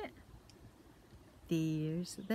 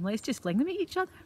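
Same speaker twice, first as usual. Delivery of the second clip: put-on excited kids; 8 semitones higher, 4 dB louder, faster than usual. Boys excited about game.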